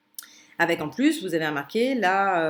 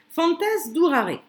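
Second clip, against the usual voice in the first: higher, slow and deliberate Latin recitation; reciting Latin; 8 semitones higher, 3 dB louder, slower than usual. Shown as a demonstration. Teacher reciting a Latin verse to students, slow and high. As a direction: read the Latin phrase out slowly, raised, for the class.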